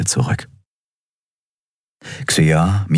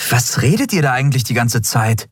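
cutting in sharply; 8 semitones higher than usual. A narrator's reading. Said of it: "fuhr ihn Endriel dazwischen".

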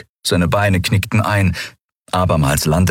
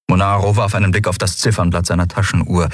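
plain reading voice

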